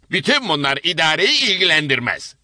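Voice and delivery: grey-haired woman correcting a latecomer; stern older woman. Acted. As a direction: brush the excuse aside.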